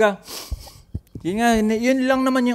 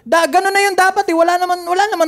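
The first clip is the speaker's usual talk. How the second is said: high, raised-voice insistence; shouting; 9 semitones higher, 4 dB louder than usual. Real, not acted. Podcaster insisting nobody really died, shouting. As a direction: argue it with pitch pushed up. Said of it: "Huwag ka kasi sumigaw".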